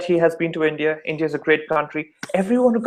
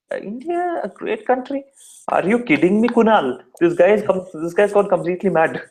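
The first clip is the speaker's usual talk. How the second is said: high-pitched disbelief, easing down toward the end; incredulous wondering; 4 semitones higher than usual. Acted. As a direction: act out baffled Indian voters questioning a claim. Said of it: "Indian folks would be sitting and wondering".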